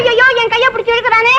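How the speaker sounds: high, protesting wail; distressed wail. Acted. Woman complains he is pulling her hand, wailing. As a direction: wail for onlookers to notice.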